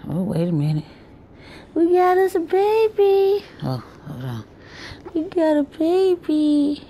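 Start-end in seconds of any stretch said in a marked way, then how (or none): 1.8-3.5 higher, slow sing-song coo. Real, not acted. Grandma coos over her new granddaughter, slow high baby talk. 5.1-6.8 higher, slow sing-song coo. Real, not acted. Grandma coos over her new granddaughter, slow high baby talk.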